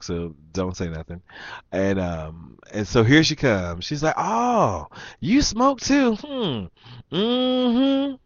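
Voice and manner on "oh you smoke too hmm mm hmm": very high mock female voice. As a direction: mimic her knowing, drawn-out hum.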